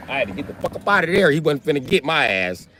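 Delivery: ordinary talk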